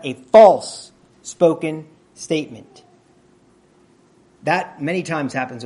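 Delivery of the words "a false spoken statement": slow, loud, leaning hard on "false"; repeated with stress. Real, not acted. Preacher repeats the definition to the church, stressing "false". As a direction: punch "false" so they catch it. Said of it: "Did you hear that?".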